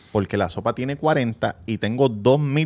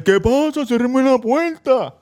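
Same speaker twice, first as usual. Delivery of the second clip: much higher, slow, put-on voice of the caught man; caught man's voice; 11 semitones higher, much slower than usual. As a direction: plead in a high, drawn-out voice to shut the door. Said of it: "Y el". (hombre sorprendido en el baño, voz aguda y lenta)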